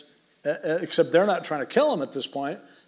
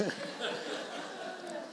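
soft laugh; 15 dB quieter than usual. Real, not acted. Laughter after son's joke.

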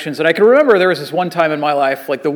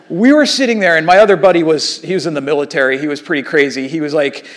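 ordinary talk, nothing marked

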